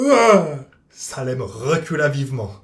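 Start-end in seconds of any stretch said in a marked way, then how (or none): 0.1-0.6 sudden high loud cry of pain; pained yelp. Acted. Cat yelping after the parrot pecks his nose. Beak strikes the cat's nose.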